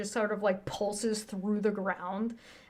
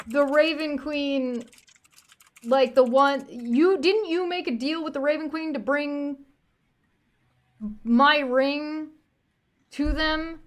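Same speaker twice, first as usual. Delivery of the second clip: higher-pitched, mildly acted disbelief; incredulous questioning; 6 semitones higher, 3 dB louder than usual. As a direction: question them with rising disbelief. Doctor character incredulously questions party.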